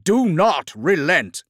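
slow, loud, high rallying shout; forceful drawn-out yell; battlefield command. Acted. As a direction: drive the troops on, drawing out each word. Commander urging troops in battle.

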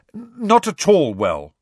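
slow, loud, high insistence; firm. A narrator's reading. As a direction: insist on it firmly, word by word. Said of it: "said Harry firmly".

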